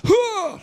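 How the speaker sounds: high sharp "Huh!" grunt; motivating grunt. Retold or imitated. Comedian psyching himself up, sharp high grunt. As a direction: bark a pumped-up grunt. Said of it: "this motivating kind of noise".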